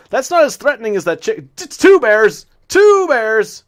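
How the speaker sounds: high, loud panicked yell about two bears; 惊慌大喊; panicked shout. Real, not acted.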